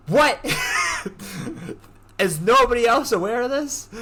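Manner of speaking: high, loud, startled "what"; retold disbelief. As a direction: blurt it out in mock alarm. Retold shocked reaction to shark warning.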